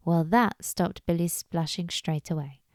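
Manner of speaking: plain reading voice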